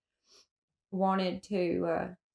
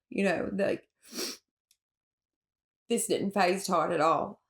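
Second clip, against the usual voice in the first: usual speaking voice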